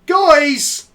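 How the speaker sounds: loud, high outcry; exclaiming loudly. Real, not acted. Streamer shouting to viewers after the draw, loud outburst. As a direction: yell it out to everyone.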